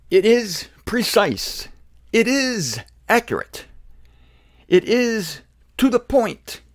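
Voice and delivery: slow, high, deliberate emphasis on each phrase; emphatic declaration. Real, not acted. Emphatic reading of the article.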